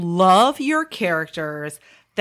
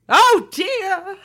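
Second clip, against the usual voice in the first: high, mock Mid-Atlantic oh dear; a quick Hepburn-style Mid-Atlantic flutter; hammed-up Mid-Atlantic accent; Katharine Hepburn; 10 semitones higher than usual. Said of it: "old Katharine Hepburn".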